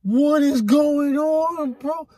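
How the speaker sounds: high, slow, stretched-out "what is going on"; alarmed disbelief. Retold or imitated. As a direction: drag it out high in shock. Retold reaction to running red light.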